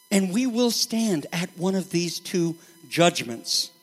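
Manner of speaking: usual speaking voice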